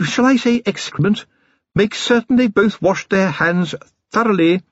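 high-pitched, matter-of-fact lady giving instructions; brisk wife. Acted. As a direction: organise the household briskly. Sybil instructing her husband.

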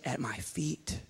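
breathy whisper. Acted. Preacher softly voicing God, whispering. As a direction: drop to a whisper.

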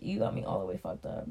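low, breathy, half-voiced aside; a hushed disbelieving mutter; muttered under breath. Repeating the line under his breath.

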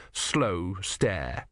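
plain reading voice

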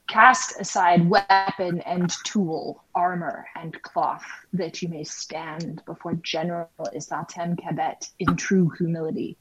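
ordinary delivery

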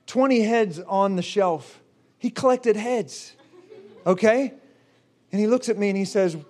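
ordinary delivery

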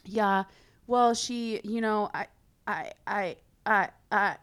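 halting, broken-up stammer; imitated stuttering. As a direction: mimic a cornered ex stumbling over words. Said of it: "like, stuttering like that".

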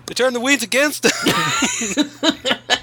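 high, slow, through laughter; laughing slow quote. Pretend. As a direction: drag it out laughing.

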